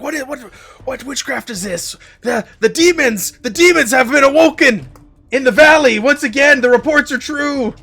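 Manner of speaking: high, loud frightened shouting villagers; panicked shouting. Acted. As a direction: yell in terror about the demons. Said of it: "they start kinda shouting".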